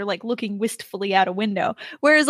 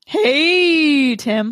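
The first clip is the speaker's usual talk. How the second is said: voice crack; 3 semitones higher, 5 dB louder than usual. Real, not acted. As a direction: voice cracking on the greeting. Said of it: "My voice cracked".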